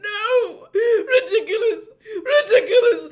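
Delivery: high, loud, sobbing cries; a mother breaking down, shrieking through tears. A narrator's reading. Missus Weasley shrieks at the boggart, sobbing. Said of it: "Missus Weasley moaned".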